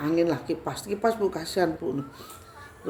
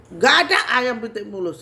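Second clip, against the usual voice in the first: raised, louder retold snap; bentakan; 5 semitones higher, 5 dB louder than usual. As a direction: cut the demand off with a loud rebuke. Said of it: "Terus saya bentak lagi". (membentak dengan suara naik)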